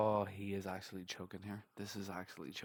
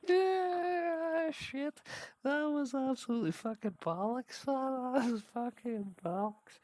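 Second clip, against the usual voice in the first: loud, much higher, still laughing; loud and high, gasping after the laugh; laughing outburst; 7 dB louder, slower than usual. Real, not acted. Reacting to the failed Bond line, loud and high.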